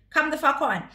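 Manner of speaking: normal talking voice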